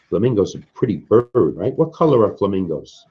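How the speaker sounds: usual speaking voice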